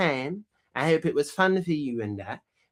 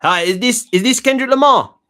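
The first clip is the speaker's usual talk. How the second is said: loud phone call; 5 semitones higher, 11 dB louder than usual. Real, not acted. Prank call, raising voice.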